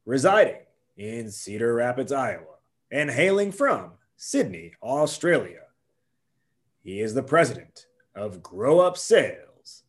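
booming wrestling ring announcer; grand entrance announcement. Acted. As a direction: stretch every name out like a ring announcer. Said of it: "This is what it needs to sound like".

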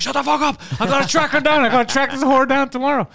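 mock furious friend. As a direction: bark it out in a rage. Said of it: "he was furious".

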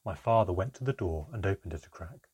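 ordinary delivery

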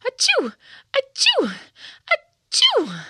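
high loud repeated sneezes; sneezing fit. A narrator's reading. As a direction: sneeze again and again.

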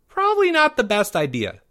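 put-on high-pitched kid voice; wary, pitched well up; doubtful young boy. A narrator's reading. Boy cautioning his lovestruck friend, high and doubtful.

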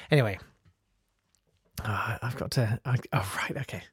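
ordinary talk

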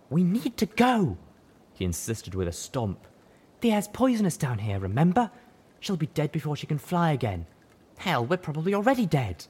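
high, nervous, pushy buck; insistent high stallion. Acted. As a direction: insist with a stomp.

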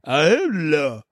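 Sylvester Stallone impression, movie-hero delivery; Stallone line. Wrestler on entrance quoting Sylvester, tough hero voice. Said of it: "or the Sylvester line".

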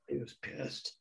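breathy whisper, no voice at all; whispered aside. Real, not acted. Telling the host how angry the singer was, whispered. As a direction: drop to a whisper for emphasis. Repeats the line, now whispered.

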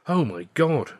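understated horrified exclamation; gasped. A narrator's reading. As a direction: react aghast to sudden bad news. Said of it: "Alf gasped".

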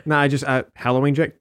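ordinary delivery

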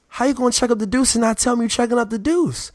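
high, whiny mock complaint; mock offended. The Sports Nation guy scolding the speaker over the peace sign, in a whiny high voice.

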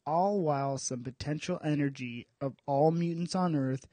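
measured, dramatic comic-book recap; story narration. A narrator's reading. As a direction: narrate the saga in an even, serious storyteller's voice.

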